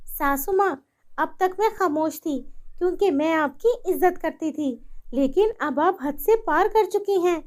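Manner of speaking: high, stern warning voice; firm daughter-in-law. Acted. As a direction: warn her firmly at last. Daughter-in-law warns firmly.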